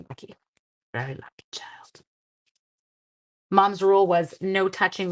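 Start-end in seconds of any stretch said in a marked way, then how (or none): 0.1-2.0 breathy whispered aside, soft. Real, not acted. To viewers about her son, whispering. Aside after risky tag story.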